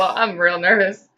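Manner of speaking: normal talking voice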